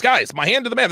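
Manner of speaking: ordinary talk, nothing marked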